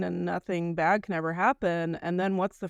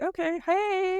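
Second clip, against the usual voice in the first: high, singing-out hello; pretend casual greeting; 12 semitones higher, slower than usual. Patient greeting visitors who show up, high mock-casual hello. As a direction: greet them with a much higher, put-on voice.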